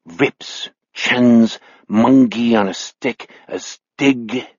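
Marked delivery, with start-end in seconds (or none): none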